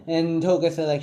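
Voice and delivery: ordinary delivery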